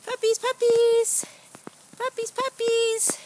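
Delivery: high loud sing-song call. Real, not acted. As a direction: call the puppies in a bright coo. Calling puppies.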